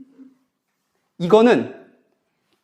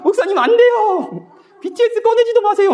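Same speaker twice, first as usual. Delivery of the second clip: put-on laughing squeal of kids saying no; teen protest. Pastor imitates youth telling him not to mention BTS, high and laughing. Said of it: "막 이러더라고요".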